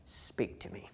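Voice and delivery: hushed, intimate plea to the Father; quiet prayer. Retold or imitated. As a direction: pray it softly, almost under the breath. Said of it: "him going and saying".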